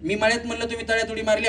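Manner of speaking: ordinary talk, nothing marked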